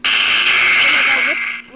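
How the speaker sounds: sudden loud scream, drawn out slowly; startled scream. Real, not acted. Player screaming at a jump scare, startled. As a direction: yell in real shock.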